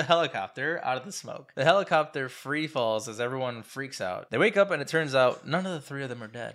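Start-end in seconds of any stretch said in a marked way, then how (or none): none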